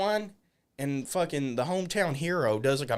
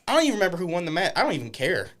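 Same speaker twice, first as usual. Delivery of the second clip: ordinary delivery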